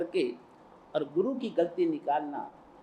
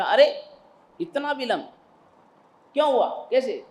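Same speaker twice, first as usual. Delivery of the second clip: stern demand; 6 semitones higher, 6 dB louder than usual. Acted. Guru demands why disciple late.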